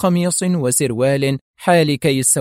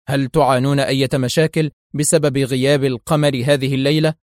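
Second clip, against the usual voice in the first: ordinary delivery, nothing marked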